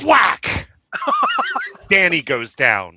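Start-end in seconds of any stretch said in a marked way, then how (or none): 0.0-0.7 sudden high, loud thwack; imitated thwack. Game master voicing a sniper shot, sharp loud thwack. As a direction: crack out a shot sound effect.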